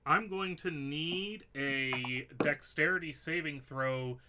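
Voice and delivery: normal talking voice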